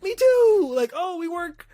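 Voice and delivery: very high, loud gushing voice; gushing to a fellow worker, very high-pitched; excited. Retold or imitated. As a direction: bubble over with fan joy. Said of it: "I was excited".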